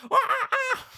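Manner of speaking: high laughing ape shrieks; mock monkey screeching. Retelling the movie monkeys screaming at her, high mock shrieks. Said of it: "they're screaming at her like".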